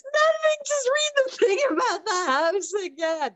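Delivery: high, tearful wail through imitated laughter; mock hysterics, squeaky and drawn out; helpless laughter. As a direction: plead slowly, as if weak from laughing. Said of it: "they're laughing their heads off".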